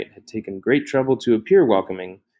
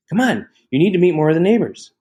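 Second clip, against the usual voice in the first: eager sister; 8 semitones higher, 4 dB louder than usual. A narrator's reading. Sister eagerly pulling her out.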